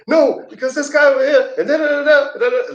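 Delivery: mock rant, very high and squeaky; high-pitched mimicry of an angry friend mid-tirade; ranting hothead. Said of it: "like, he's going off".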